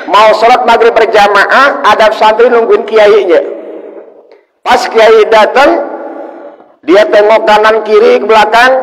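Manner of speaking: high, loud building narration; preaching at full force. Acted. Preacher builds suspense for the congregation, loud and high.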